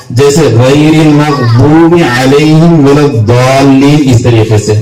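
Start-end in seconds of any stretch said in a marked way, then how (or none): 0.4-4.1 slow recitation, "الضالین" with tongue side on upper molars; dwad recitation demo. Shown as a demonstration. Teacher giving example of dwad, slow drawn-out Quran recitation. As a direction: draw out the dwad in "المغضوب" and "الضالین". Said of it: "داڑھوں کو لگانے سے دواد کا مخرج نکلتا ہے".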